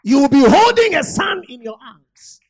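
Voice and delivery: booming promise; loud proclamation. Acted. Elisha promises the woman loudly. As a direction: proclaim it over her.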